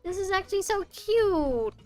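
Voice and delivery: high-pitched adoring gush. Real, not acted. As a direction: let the voice soar with delight. Gushing over the statue.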